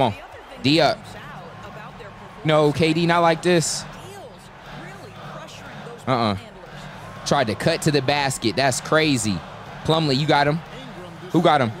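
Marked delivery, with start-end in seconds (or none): none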